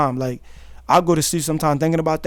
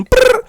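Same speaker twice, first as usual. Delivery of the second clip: playful trill; 6 dB louder than usual. Real, not acted. Playful noise, very high.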